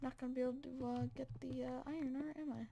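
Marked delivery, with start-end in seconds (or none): none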